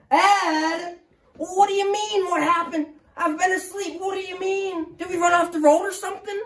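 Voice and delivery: Ed waking up confused in the car; startled old man. Acted. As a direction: sputter in confusion after dozing off. Ed wakes confused.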